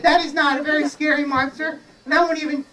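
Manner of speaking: high, quick, dismissive fairy. Acted. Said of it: "But the fairy said".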